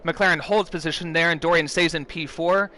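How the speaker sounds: loud, high, excited play-by-play; motorsport commentator. Real, not acted. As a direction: call the opening lap at full pace. Live play-by-play of first corner.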